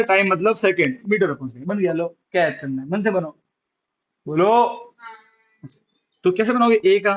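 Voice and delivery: ordinary talk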